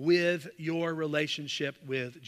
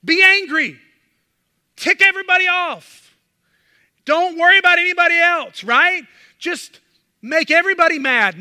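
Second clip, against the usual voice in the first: mock urging shouted, higher and louder; the world's mocking urging; 7 semitones higher, 10 dB louder than usual. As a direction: preach the world's voice as a taunting loud command. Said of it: "the world is congratulating you for being angry".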